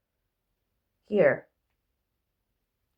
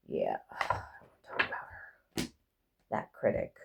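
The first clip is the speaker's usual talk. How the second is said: whisper; 12 dB quieter, slower than usual. Real, not acted. Sighing aside.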